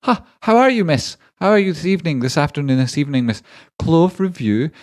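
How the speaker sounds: a syrupy, over-the-top thank-you voice reading out donations; gushing high voice. Acted. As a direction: streamer hamming up thanks in a squeaky high voice. Greets donor in put-on voice.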